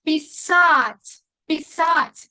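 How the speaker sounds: писать, final A punched and falling; stress on the A. Shown as a demonstration. Teacher stressing an important accent, long strong A in писать. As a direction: hit the SAT in писать firmly. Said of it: "Tilde se queda en la letra A".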